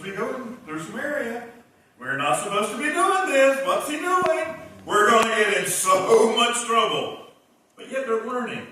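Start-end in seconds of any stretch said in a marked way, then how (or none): 2.0-3.7 louder and higher, leaning on "doing"; rising alarm. Retold or imitated. Disciples' worry rising in repeat. 3.7-7.3 louder, higher fretting, slowing at the end; hammed-up nervous disciples; worried whispering turned loud; mock panic.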